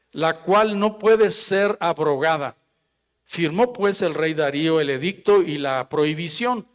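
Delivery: scripture reading. Acted. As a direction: proclaim the verses to the congregation.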